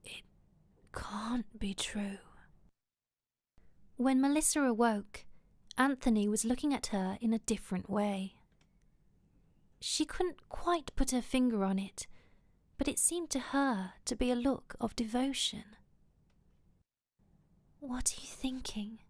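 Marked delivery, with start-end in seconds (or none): 0.0-2.2 very slow, soft half-whisper; stunned inner thought. A narrator's reading. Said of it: "he thought". 17.8-19.0 soft, breathy intimate question; whispered. A narrator's reading. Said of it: "she whispered".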